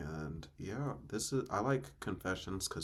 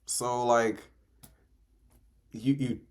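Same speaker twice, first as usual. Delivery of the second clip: loud disbelief; 3 semitones higher, 9 dB louder than usual. Real, not acted. Incredulous about choking the deer.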